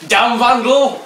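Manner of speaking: loud, high outraged yell; yelled insult. Retold or imitated. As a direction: shout it after the fleeing vandal. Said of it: "They yelled".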